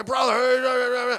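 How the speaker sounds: loud pushy calling out, imitated; mock yell at a stranger on the street; in-your-face mock hollering. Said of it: "We're not talking in people's face".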